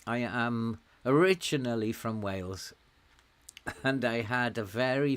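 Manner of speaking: ordinary talk, nothing marked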